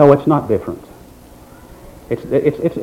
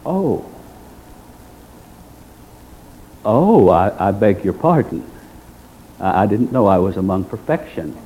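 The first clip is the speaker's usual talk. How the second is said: soft, low sarcastic apology; bow and scrape in mock humility to the faultless; 5 semitones lower, 4 dB quieter than usual. Preacher mocking self-righteous churchgoers with a soft sarcastic apology.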